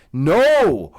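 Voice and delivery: loud stunned no; horrified no. Real, not acted. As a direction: blurt it out in horror. Horrified at his own oversight.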